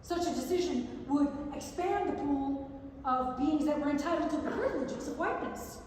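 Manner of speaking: usual speaking voice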